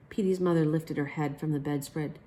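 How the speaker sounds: plain reading voice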